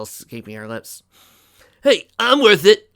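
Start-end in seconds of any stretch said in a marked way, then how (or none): 1.8-2.9 high, loud, drawn-out protest; indignant yell. A narrator's reading. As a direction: yell back in mock offence. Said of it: "yelled Dust".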